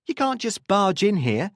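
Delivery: high-pitched, indignant protest. A narrator's reading. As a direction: object as police push in. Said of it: "she protested".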